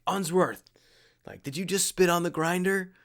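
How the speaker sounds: incredulous mock reprimand; instructor's challenge. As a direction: imitate an instructor calling out an offender. Retold SEAL confronts him for spitting.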